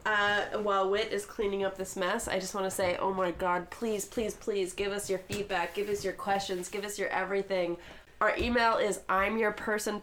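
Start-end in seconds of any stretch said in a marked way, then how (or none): none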